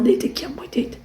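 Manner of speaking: a mother quietly settling her dog; soft coaxing to dog. Real, not acted. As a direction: hush the dog to lie down. Soothing dog to lie down.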